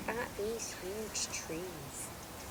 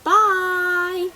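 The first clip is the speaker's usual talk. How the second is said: ordinary delivery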